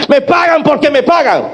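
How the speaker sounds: high, hard demand for payment; a creditor with no mercy, pressing hard; harsh demand. Acted. Creditor demanding payment from the poor debtor, harsh and high.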